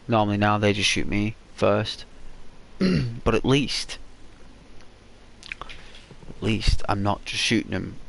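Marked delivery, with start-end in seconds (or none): none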